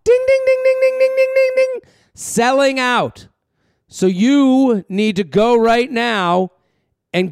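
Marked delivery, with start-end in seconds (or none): 0.0-1.9 very high sung ding ding ding; high bell imitation. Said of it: "Ring that bell".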